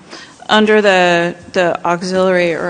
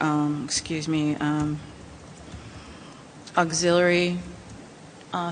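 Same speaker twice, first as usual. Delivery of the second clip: soft, off-mic voice, a bit softer; too quiet to hear; 7 dB quieter than usual. Real, not acted. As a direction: speaks softly, hard to hear. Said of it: "Can you speak into your mic, please? It's hard for me to hear you".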